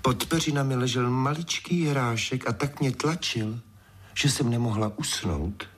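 a princess's understated complaint; lamenting. A narrator's reading. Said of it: "zanaříkala".

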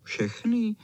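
high, cheeky dog voice; chuckling dog. A narrator's reading. Cvoček teasing the chow chow, high and cheeky. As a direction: pitch it up as a teasing question. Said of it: "Chechtal se Cvoček".

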